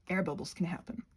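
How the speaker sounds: normal talking voice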